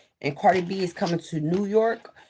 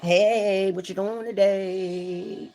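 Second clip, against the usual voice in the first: bouncy, teasing hey babe; playful greeting; 3 semitones higher, slower than usual. Retold or imitated. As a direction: greet him while dancing. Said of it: "I'll be dancing like".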